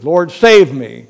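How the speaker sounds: loud, slow plea for rescue; desperate cry. Acted. Sinking Peter crying to the Lord, loud and slow. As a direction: call out slowly as if going under.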